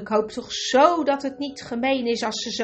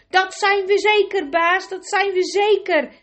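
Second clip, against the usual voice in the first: high, loud chorus echo; eager echo; 9 semitones higher, 6 dB louder than usual. A narrator's reading. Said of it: "echoden de anderen". (hoog en luid nagezegd)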